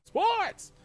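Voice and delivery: high, loud hype yell; excited shout. Real, not acted. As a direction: shout it like an announcer. Hyping the sports segment.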